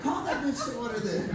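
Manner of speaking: lightly squeaky high, mocking; a hint of a sneering teen; mock pompous. Son mocking the dad's formal call to order. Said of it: "I wanted to hammer".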